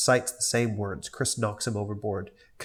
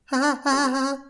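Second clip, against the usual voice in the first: put-on much higher, childlike ha-ha; high cartoon kid laugh; kid's laugh. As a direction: giggle like a hyper little boy. Cleveland Junior laughing.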